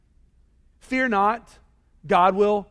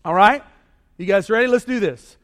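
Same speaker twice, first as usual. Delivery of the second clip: ordinary delivery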